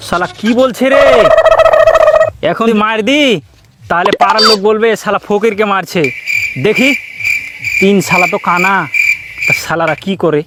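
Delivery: ordinary talk, nothing marked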